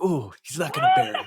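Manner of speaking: high, fast mock gasp of relief; playful teasing squeal; mock surprise. Mocking the line.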